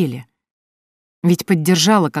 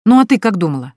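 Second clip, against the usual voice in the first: ordinary delivery, nothing marked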